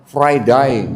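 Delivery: "Friday" the Australian way, ending like "die"; Australian "Friday" with a wide "eye" ending; Australian pronunciation. Shown as a demonstration. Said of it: "Orang Australia ngomongnya apa?". ("Friday" logat Australia, berakhir "dai")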